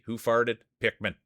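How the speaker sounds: ordinary talk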